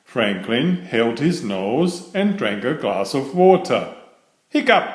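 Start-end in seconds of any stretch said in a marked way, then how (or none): 4.5-4.9 hiccup. Acted. Franklin's hiccups.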